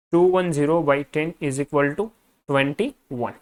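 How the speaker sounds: very slow English dictation, word by word. Shown as a demonstration. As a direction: read the answer out for students to copy. Dictates the answer for notes.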